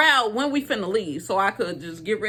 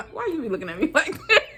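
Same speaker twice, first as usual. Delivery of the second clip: laughing question; 5 semitones higher, much faster than usual. Real, not acted. Laughing at listener.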